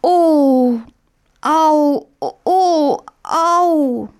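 high, drawn-out moans of pain; hammed-up wailing "oh, ow"; pitiful groaning. A narrator's reading. Brother in bed at dawn, pitiful groaning. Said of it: "ein jämmerliches Stöhnen".

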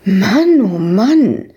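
swooping, drawn exclamation of dismay. A narrator's reading. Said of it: "rief Ewke aus".

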